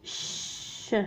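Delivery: ش said as a soft voiceless hiss; soft voiceless sh sound for ش; sh sound shown. Shown as a demonstration. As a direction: hiss a quiet sh for ش. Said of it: "سي اش".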